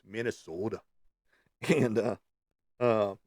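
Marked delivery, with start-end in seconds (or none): 0.0-0.8 Minnesota with a lightly Minnesotan long round o; a playful Minnesotan Minnesota; Minnesotan accent. Shown as a demonstration. Correcting the boring version, in a light Minnesotan accent. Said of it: "you gotta say it like that".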